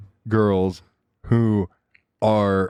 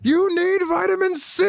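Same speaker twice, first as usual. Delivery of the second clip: put-on very high, screechy child tantrum; frantic daughter. Daughter trying to save mother with Go-Gurt, frantic. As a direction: scream it like a desperate little girl, hammed-up.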